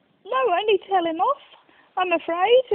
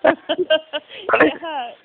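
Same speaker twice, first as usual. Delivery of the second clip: words through sore laughter; wincing while still laughing; laughing in pain; 3 dB louder than usual. Real, not acted. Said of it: "I've got bad ribs".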